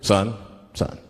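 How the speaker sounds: low-pitched traffic cop voice; deep, deliberate officer questioning a driver; police officer. Acted. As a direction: drop low like a cop at the car window. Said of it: "the police pull me over".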